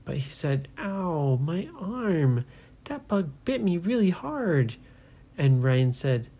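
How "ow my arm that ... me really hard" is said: put-on higher, whining boy; boy complaining about a bug bite; whiny complaint. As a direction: whine about the sting. Brady bitten, complaining.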